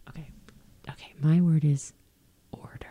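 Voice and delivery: hushed secret into the mic; whisper. Real, not acted. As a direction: whisper it right in your ear. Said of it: "I'm gonna whisper it into the microphone".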